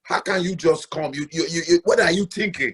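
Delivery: a hammed-up reproach for skipping a visit; scolding African auntie. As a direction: play the offended host, nagging. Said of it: "You know, they say".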